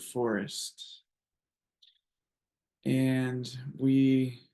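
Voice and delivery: calm, even visualization voice with long pauses; guided meditation. Acted. Host guiding the circle through a redwood bonfire visualization, calm guided meditation. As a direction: ease them into the scene, phrase by unhurried phrase.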